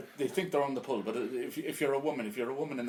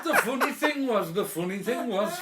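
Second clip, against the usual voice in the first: laughing; 10 semitones higher, 9 dB louder than usual. Real, not acted.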